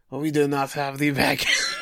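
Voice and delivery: hammy fake French accent, shouted; joking at French listeners in a bad French accent. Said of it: "followed up with a bad accent".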